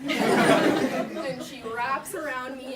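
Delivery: loud, slow words broken by real laughter; can't stop laughing while telling it. Real, not acted. Laughing while retelling the fight.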